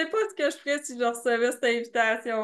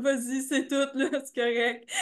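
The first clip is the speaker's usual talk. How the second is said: laughing; 3 semitones higher than usual. Retold or imitated. Retold Laz waving her on, amused.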